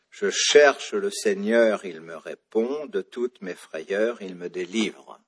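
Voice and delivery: "Je cherche le Seigneur, il me répond. De toutes mes frayeurs, il me délivre" slowly, leaning on each phrase's last stressed syllable; marked accented syllables. Shown as a demonstration. Teacher counting accents for students, "Je cherche le Seigneur, il me répond. De toutes mes frayeurs, il me délivre" in stressed beats. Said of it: "Trois syllabes".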